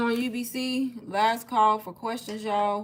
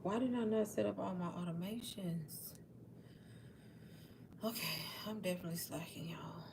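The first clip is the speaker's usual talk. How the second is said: very soft, half-whispered aside; whispered self-reproach, barely voiced; muttering to oneself; 3 semitones lower, 15 dB quieter than usual. Real, not acted. Host scolding self over forgotten automations, muttering under the breath.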